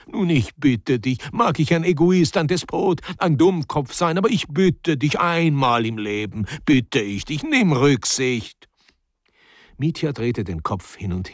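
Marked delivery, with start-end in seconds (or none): 0.1-8.7 high, whiny, exasperated begging; exasperated pleading. Acted. Older man begging Mitja for consideration, exasperated. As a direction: beg insistently, drawing out the last appeal.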